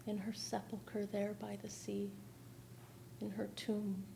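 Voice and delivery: hushed whispered verse. Acted. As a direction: let it fade into a whisper. Hushed line in poem reading.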